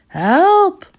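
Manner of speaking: high, loud yell for help; shout for help. A narrator's reading. Mister Noisy shouting for help to find the way out, very loud. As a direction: yell it with all your might. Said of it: "shouted at the top of his very, very loud voice".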